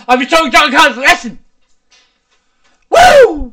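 loud fast boast ending in a whoop; triumphant shout. Real, not acted. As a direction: crow about winning.

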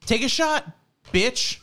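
high, punchy dog demand; insistent button dog. Retold or imitated. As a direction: imitate a dog pressing its buttons pointedly.

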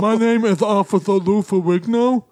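put-on officer voice, thick rounded consonants; hammed-up celebrity cop impersonation; Lou Ferrigno. Officer introducing himself to a driver, impersonated. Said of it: "That's my Lou Ferrigno impersonation".

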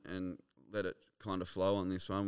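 ordinary delivery

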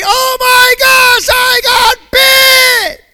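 high, loud, stretched panic shout; mock panicked yell. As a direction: ham up a panicked victim screaming. Mocking panicked churchgoer.

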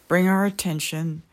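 usual speaking voice

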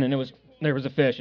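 ordinary talk, nothing marked

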